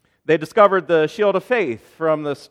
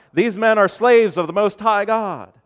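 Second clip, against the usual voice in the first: raised, higher-pitched mock proclamation; imitated crying out; 7 semitones higher, 5 dB louder than usual. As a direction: call it out like the slave girl, louder and higher. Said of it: "she would cry out".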